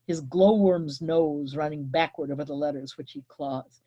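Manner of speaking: ordinary talk, nothing marked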